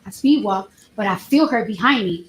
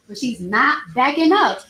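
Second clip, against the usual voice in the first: ordinary talk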